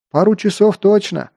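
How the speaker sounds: high shout over the roar. Acted. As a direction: call out over the thunder. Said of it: "тоже закричал Бертом".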